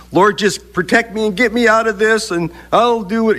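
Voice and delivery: mock desperate prayer. Pleading prayer quoted mockingly.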